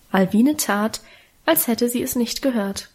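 usual speaking voice

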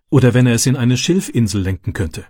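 ordinary delivery

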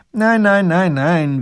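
put-on high, loud childish refusal; a little duke brushing it all aside; waving it off. Little grand duke refusing the captain's furs, dismissive. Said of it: "winkt der kleine Großherzog ab".

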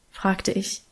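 plain reading voice

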